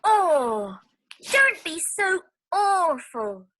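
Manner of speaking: high, loud cry of complaint; high-pitched cry of dismay. Acted. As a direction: wail like a splashed girl. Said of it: "She cries out".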